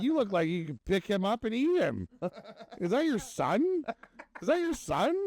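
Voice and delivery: very high mock-incredulous teasing; imitated joker. Mimics guy joking about their sizes.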